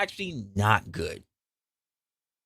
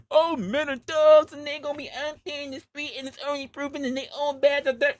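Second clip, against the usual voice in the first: higher mocking voice of women complaining; mock women's voice; 11 semitones higher than usual. Women calling all men thugs, mocked in higher voice. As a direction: parrot the complaint, pitched up.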